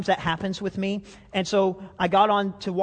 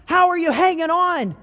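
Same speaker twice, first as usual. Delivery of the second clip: much higher, louder call down the rock face; calling down the cliff; 10 semitones higher, 6 dB louder than usual. Retold or imitated. Buddies calling over the edge to Jim, high loud call. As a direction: shout down to a friend dangling below. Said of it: "they said".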